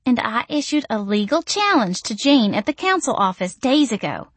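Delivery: sweet high-pitched coo. A narrator's reading. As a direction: coo it to charm them. Missy's voice continues.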